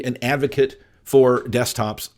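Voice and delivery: ordinary talk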